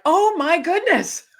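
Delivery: high, slow gasp of wonder; a high, slow and childlike "oh my goodness"; awed exclamation. Retold or imitated. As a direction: marvel at it like a child. Acted awe exclamation.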